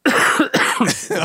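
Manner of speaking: a quick demo cough. Shown as a demonstration. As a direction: fake a small cough. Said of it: "とか言ってやると".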